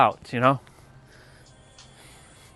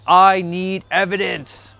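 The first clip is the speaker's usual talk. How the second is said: slow, drawn-out demand, pitched up; imitating Clayton; 5 semitones higher, 3 dB louder, slower than usual. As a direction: stretch each word out insistently. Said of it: "and he's like".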